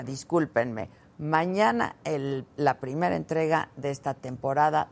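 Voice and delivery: ordinary talk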